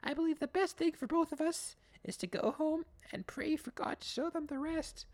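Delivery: sweet prim lady voice; mock saintly tone; imitating Katrina. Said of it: "Katrina's like".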